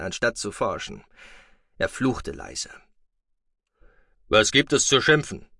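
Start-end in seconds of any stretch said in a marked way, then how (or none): 4.3-5.5 stern scolding. Acted. Stern foster father scolding.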